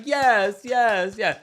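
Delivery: high, loud mock hype; imitated cheering. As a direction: egg someone on as if clapping along. Mimics Stacey clapping along, cheering.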